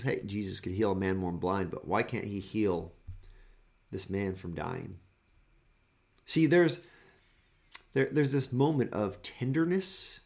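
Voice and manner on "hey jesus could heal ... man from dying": mocking jeer. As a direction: mutter it with a sneer. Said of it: "they mock Jesus".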